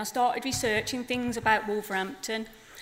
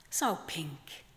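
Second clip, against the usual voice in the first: softer, whispered so pink; whispered echo; 6 semitones lower, 8 dB quieter than usual. Acted. Grandma flamingo echoing her words to herself, whispered. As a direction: breathe the repeat like a secret. Poem line repeated as whisper.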